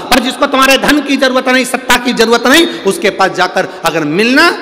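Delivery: high, loud, driving exhortation; forceful preaching. Real, not acted. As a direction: drive each demand home to the congregation. (ऊँची, ज़ोरदार आवाज़ में प्रवचन)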